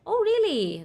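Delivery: ordinary talk, nothing marked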